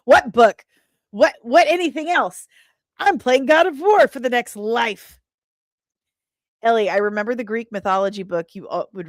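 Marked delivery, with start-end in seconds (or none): 0.0-5.2 loud, high, incredulous what-what; mock oblivious gamer. Streamer imitates herself lost in God of War, mock incredulous. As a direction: act baffled that anything else exists. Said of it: "I'm like".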